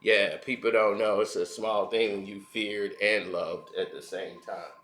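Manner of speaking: low, smug mock [name] musing; imitating [name]. Mocking [name]'s ego as he talks about fear. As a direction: go low and self-important like [name]. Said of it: "He was like".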